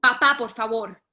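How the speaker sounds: loud, urgent plea. Acted. Daughter pleading with her furious father, loud plea. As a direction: beg him to stop.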